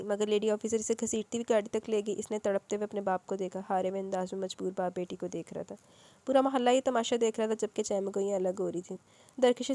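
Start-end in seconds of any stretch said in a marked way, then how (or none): none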